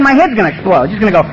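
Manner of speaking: normal talking voice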